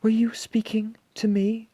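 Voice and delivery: very high, airy whispery voice; dainty monster voice; not at all monstrous. A narrator's reading. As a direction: ask politely, high and light. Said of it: "in a voice that was not at all monstrous".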